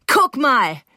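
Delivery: high, loud exclamation; triumphant showing off. A narrator's reading. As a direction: demand that they look, proudly. Rose shows off as stairs fall.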